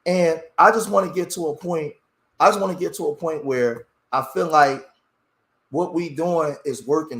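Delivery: normal talking voice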